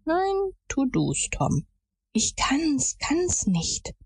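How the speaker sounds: put-on high, breathy boyish plea; frightened refusal. A narrator's reading. Terrified boy refusing his friend's urging, frightened and high. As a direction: shrink back in a high, hushed voice.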